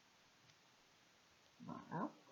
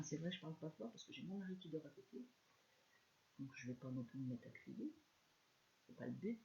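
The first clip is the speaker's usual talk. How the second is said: speaking softly; 9 dB quieter than usual. Real, not acted. Crafter keeping quiet for her sleeping husband, hushed. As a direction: keep it soft, husband asleep next door.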